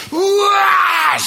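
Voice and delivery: imitated demonic shriek; mock wail. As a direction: let out a short howl. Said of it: "she starts manifesting".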